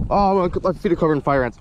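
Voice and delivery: normal talking voice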